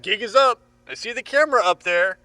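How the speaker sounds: loud, high, laughing call-out; excited taunting. Acted. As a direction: bust the prankster cheerfully. Thinks friend is pranking him.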